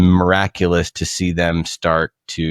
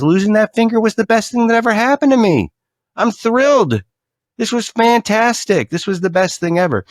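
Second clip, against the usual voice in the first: much higher, fast, giddy voice; overjoyed king; 13 semitones higher than usual. Acted. King rejoicing to his court, overjoyed. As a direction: gush like a delighted king.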